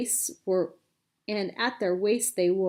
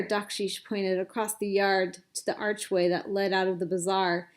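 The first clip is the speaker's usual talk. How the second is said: ordinary delivery, nothing marked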